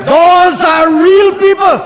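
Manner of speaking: building parliamentary tirade; fiery oratory. Real, not acted. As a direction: rail at the opposition benches.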